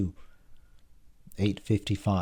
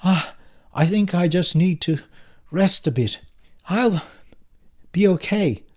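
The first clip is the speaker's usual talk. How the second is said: higher, halting feigned faintness; put-on breathless invalid; ragged breathing; 9 semitones higher, 5 dB louder than usual. A narrator's reading. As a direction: act faint, pausing for breath between words. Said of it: "continue my ragged breathing".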